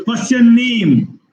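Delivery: "What's your" squeezed, "name" stretched high then falling; expanded important word. Shown as a demonstration. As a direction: hurry through "What's your" and lengthen "name". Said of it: "expand some important words".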